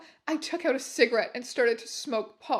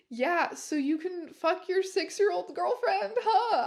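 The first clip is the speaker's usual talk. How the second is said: higher pitched, mocking jab ending in a high huh; sarcastic shout; 7 semitones higher than usual. Heroine taunting Lupin about condoms, sarcastically. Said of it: "I shouted sarcastically".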